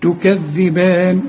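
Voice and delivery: تكذبان slow with a normal two-beat madd; natural madd. Shown as a demonstration. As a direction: recite تكذبان evenly, the long a held two beats. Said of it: "والمد مد طبيعي".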